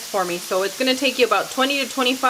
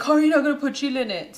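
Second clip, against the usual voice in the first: put-on quick whiny child voice; kid's complaint; 5 semitones higher, faster than usual. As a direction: mimic a small girl pleading. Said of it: "She's like".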